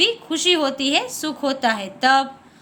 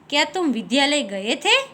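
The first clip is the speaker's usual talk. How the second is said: rising question ending on थे; questioning tune. Shown as a demonstration. Teacher giving a question-mark example, rising on थे. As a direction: ask it, lifting the pitch on थे. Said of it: "हम किसी से पूछते थे कि".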